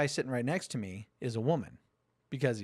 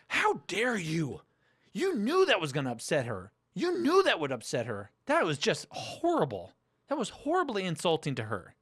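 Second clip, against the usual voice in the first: hammed-up indignant panelists; mock outrage; 5 semitones higher than usual. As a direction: parody the offended panel. Mocking outraged panelists again.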